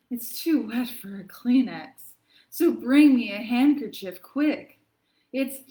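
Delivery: hammed-up reading of a sniffly poem; milk every sneeze for the kids; dramatic poem reading. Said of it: "lends itself to a pretty dramatic reading".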